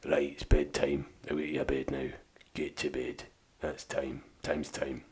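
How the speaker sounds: low, soft Scottish parent voice; deep Scottish voice. Shown as a demonstration. Parent sending kids to bed, deep Scottish. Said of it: "That's the one I do the most".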